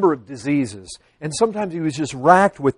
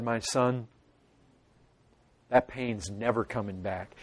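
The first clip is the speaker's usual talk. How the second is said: much lower, hushed, gentle promise; voice of God; 9 semitones lower, 11 dB quieter than usual. Acted. God comforting his suffering son, hushed and low. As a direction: speak softly as God comforting.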